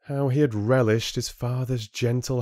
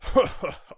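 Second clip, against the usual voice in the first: high, put-on chieftain chuckle; 12 semitones higher than usual. A narrator's reading. Chieftain amused by Rin's doom warning, chuckling. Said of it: "The chieftain chuckled".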